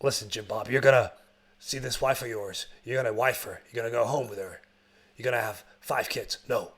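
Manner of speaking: low, soft, mock-heartfelt soldier talk; earnest war buddy. Soldier to his doomed best friend, low mock-earnest. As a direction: parody a tender buddy pep talk, voice dropped.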